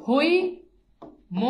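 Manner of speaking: Haimanti stretched out very slowly; very slow word. Shown as a demonstration. Teacher to class, spelling out the word, very slowly. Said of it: "এটা খেয়াল করে দেখেন".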